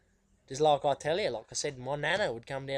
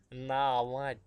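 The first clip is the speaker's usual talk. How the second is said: normal talking voice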